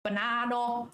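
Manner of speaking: Banana said "ba-nano", open o at the end; granddad's banana. Retold or imitated. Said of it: "how he pronounced banana".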